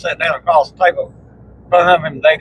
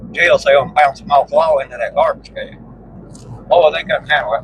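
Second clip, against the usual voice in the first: ordinary talk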